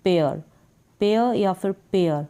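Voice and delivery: teacher modelling पेयर for students; pair pronunciation. Shown as a demonstration. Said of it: "पी को प पढ़ेंगे और एयर".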